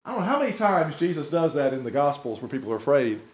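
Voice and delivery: usual speaking voice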